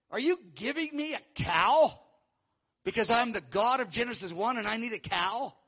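indignant rhetorical questions; incredulous God. Acted. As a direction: challenge them in disbelief at the offering. God scoffing at the offering.

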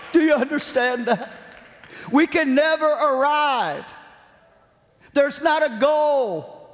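high-pitched, forceful sermon delivery; impassioned preaching. Real, not acted. Preacher urging congregation to depend on God, impassioned high preaching. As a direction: preach it with soaring pitch, driving each point home.

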